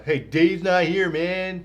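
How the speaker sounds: slow, high stoner drawl; lazy mumbling stoner. Retold or imitated. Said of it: "the different skits and stuff where it was like".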